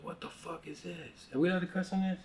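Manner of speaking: put-on a little kid startled by the question; bewildered second grader. Second grader asking classmates at recess, baffled. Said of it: "I was like".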